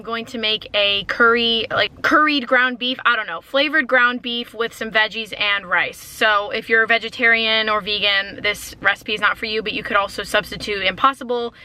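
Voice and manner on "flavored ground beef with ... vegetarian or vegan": ordinary delivery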